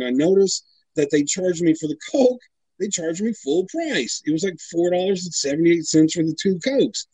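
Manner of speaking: ordinary talk, nothing marked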